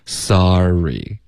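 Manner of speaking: "sorry" stretched, R growing out of sa; unbroken sar-ree glide; continuous airflow. Shown as a demonstration. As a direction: flow through the R in "sorry". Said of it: "因为你气流没断".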